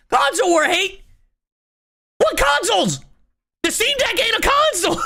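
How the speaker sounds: high-pitched, exasperated disbelief; incredulous outrage. Real, not acted. Streamer rejecting console war claim, incredulous outrage.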